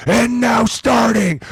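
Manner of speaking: high, slow stadium announcer shout; mock arena announcer; announcer call. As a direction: boom it out like the PA announcer. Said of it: "they said".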